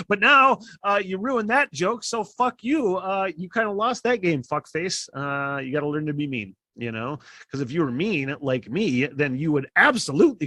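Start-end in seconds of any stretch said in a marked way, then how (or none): 0.1-5.1 mock scolding. Real, not acted. Mock scolding buddy, laughing.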